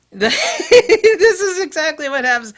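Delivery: high loud laughing through words. Real, not acted. Vlogger laughing while describing cheesy review music.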